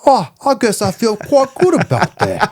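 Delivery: high, hammed-up British chimney-sweep accent. Actor's reply imitated by interviewer, exaggerated British accent. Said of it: "his real accent sounds like he's, like, a British chimney sweep".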